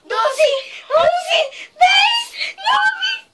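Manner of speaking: high rising squeal, laughing and crying; shrieking laugh-cry. Real, not acted. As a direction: climb into a laughing scream. Countdown ending, burning.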